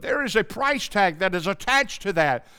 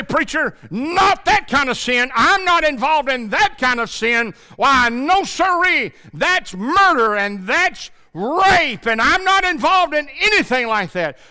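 mock objector; 9 semitones higher, 6 dB louder than usual. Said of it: "You might say".

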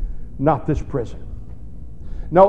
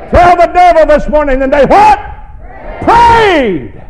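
louder, higher call and response; rally the church to shout back; loud call to congregation; 11 semitones higher, 7 dB louder than usual. Real, not acted. Pastor urging congregation to shout the answer, loud. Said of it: "Real loud".

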